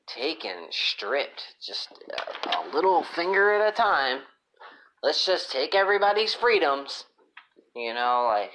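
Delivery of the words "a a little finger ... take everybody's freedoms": mocking voice. Mocking the app makers.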